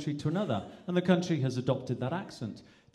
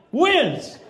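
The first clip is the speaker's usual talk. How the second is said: high, loud Welsh accent; loud, falling Welsh-accented shout; 11 semitones higher, 9 dB louder than usual. Shown as a demonstration. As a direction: call it out high in a Welsh lilt. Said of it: "the Indians migrated to Wales".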